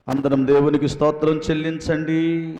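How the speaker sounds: ordinary delivery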